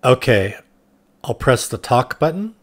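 ordinary talk, nothing marked

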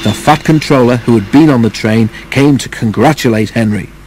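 plain reading voice

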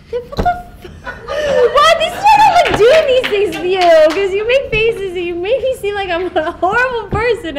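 high, loud laughing protest; laughing outburst. Real, not acted. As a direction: squeal it out while laughing at him. Laughing outburst at co-star's faces.

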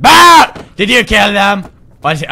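loud high jump-scare yell; startled shout. Real, not acted. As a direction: shout in shock. Startled by a character.

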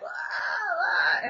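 his scream retold. His scream coming back at her. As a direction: answer her scream with your own. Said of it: "he was coming back".

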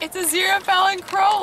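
high, thrilled cry of recognition; imitated excited fans. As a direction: squeal it like a fan spotting the characters.

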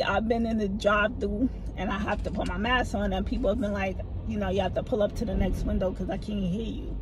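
ordinary delivery

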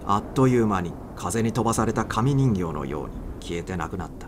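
ordinary delivery, nothing marked

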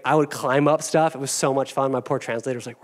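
ordinary delivery

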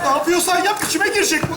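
high, urgent cry for help; panicked pleading. Acted. Trapped man begging friend to free him from spider, panicked. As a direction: beg for rescue in rising panic.

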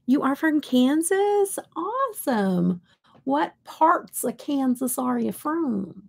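high, slow mock Kansas twang; hammed-up Kansas accent. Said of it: "We don't talk like that".

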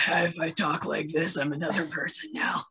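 half-hearted superhero impression; Batman. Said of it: "trying to do their best Batman voice".

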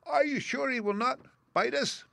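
tiny, very high, timid mouse; timid squeak. A narrator's reading. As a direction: ask nervously in a little squeak. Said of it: "asked in a timid voice".

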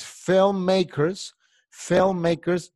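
high, slow drawn-out model word; slow modelling. Shown as a demonstration. Teacher modelling filmmakers for the class. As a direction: stretch the word out for learners.

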